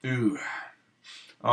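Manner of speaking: usual speaking voice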